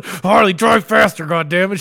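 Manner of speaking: retold frantic order to the driver; hammed-up urgency, pushing to escape; urgent plea. Retold urging driver to flee mom.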